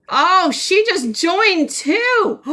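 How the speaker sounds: high, slow and amazed; real surprised gush; surprised delight. Real, not acted. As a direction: notice her joining with delight.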